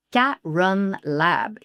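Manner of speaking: "Karen Lab" very slowly; slow English "Karen Lab"; slow English pronunciation. Shown as a demonstration.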